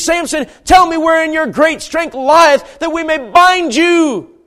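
high, loud, drawn-out demand; Delilah. Acted. Delilah demanding the secret of his strength, loud and insistent. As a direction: press him insistently, stretching the words. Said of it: "She says".

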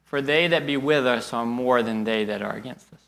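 soft, low and steady; calm reassurance. Retold or imitated. Prophet calmly reassuring his terrified servant, soft and steady. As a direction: quietly settle a frightened man. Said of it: "Elisha just looks at him and says".

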